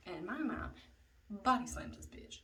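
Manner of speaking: usual speaking voice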